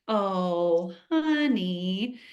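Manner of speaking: a lightly voiced, gentle "oh honey"; calm and soothing. [name] calming her spilling kid, lightly voiced soothing tone. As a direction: soothe a child who just spilled. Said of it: "and just so calm".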